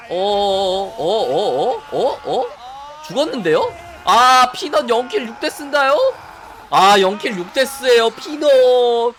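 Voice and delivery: loud, rising hype caster call; stretching out the final name; esports caster. Real, not acted. Caster calling the last fight.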